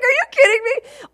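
incredulous. Retold or imitated. Retelling her amazement at the friend's news, high and incredulous. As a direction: squeal with disbelief.

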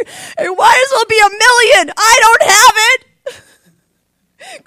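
loud, high, tearful wail, slowing down; breaking into tears, crying out. Retold or imitated. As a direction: sob it out in despair.

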